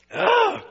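brief loud outburst; sudden high yell. Real, not acted. Preacher breaking off his prayer, sudden loud yell. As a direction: burst out with a high cry mid-prayer.

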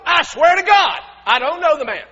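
high, shouted denial; shouted oath. Acted. Peter denies Jesus again with an oath, shouted. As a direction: swear it at full volume. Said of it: "He denied it again with an oath".

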